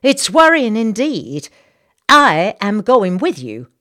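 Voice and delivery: loud, slow, firm declaration; determined Southern lady. Acted. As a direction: insist on coming along. Missus Bartlett insists.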